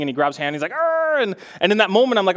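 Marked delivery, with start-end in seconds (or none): 0.7-1.2 high imitated little-kid growl; playful bear growl. Four-year-old wrestling dad, playful imitated growl. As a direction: growl like a child playing bear. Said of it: "We do this little bear claw thing".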